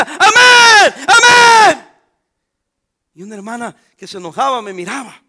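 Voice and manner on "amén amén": high, full-throated hammed-up amen shouts; shouted amen. Young man in the back pew shouting: loud hammed-up amen. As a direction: belt out amen from the back row. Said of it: "yo decía".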